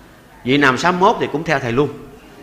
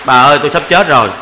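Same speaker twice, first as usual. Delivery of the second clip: louder hammed-up moaning about being ill; mock sick complaint; 3 semitones lower, 9 dB louder than usual. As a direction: overplay the sick old man for laughs. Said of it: "nói".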